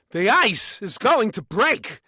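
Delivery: high, slow, loud movie-line impression; Christopher Walken impression. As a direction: stretch it out, pitched high and loud. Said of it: "I do Christopher Walken's voice matches".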